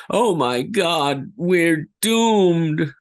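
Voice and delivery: high, slow, despairing wail; terrified. Shown as a demonstration. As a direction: cry out that all is lost. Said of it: "Like so".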